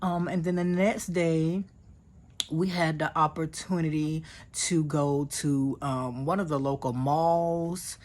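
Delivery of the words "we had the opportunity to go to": usual speaking voice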